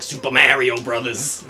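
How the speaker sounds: loud, imitated Long Island accent; mock a Long Island kid, loudly; put-on Long Island accent, punched out. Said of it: "from Long Island, they were like".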